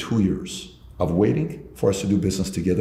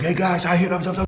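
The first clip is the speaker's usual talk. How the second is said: eager salesmen; 9 semitones higher than usual. Retold or imitated. Salesmen voiced calling out eagerly.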